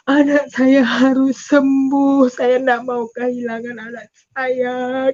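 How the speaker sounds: slow, drawn-out wail; anguished lament, stretched out; tearful prayer. Retold or imitated. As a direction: insist through tears. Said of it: "Saya menangis".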